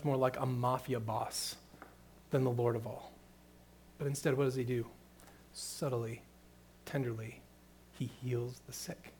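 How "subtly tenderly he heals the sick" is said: softer, gentle and slow; tender. Real, not acted. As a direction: ease off into tenderness. Contrast with harsh voice.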